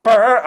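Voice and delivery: loud buzzing brrr, quick. Acted. Actor tries his character's spinning sound, loud buzzing brrr. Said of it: "I can't remember it".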